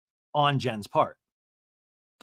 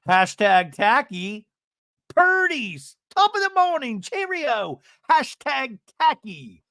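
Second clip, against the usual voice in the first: high, loud, hammed-up proclamation; mock catchphrase; 9 semitones higher, 5 dB louder, slower than usual. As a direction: stretch it out in a squawky parody. Mocking Ryan's hashtag catchphrase, callbacks.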